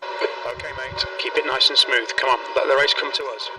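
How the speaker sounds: ordinary delivery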